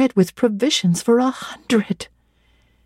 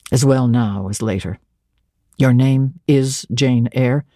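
lower, dry teasing clergyman's voice; St. John Rivers, low; 8 semitones lower than usual. A narrator's reading. Stern clergyman teasing Jane, in a lower voice. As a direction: play a reserved man gently mocking, lower.

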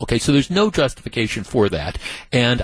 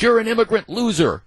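much higher, sneering retold insult; mock yell; 9 semitones higher, faster than usual. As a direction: spit the insult up high like the angry dad. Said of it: "along with all the stuff he's yelling".

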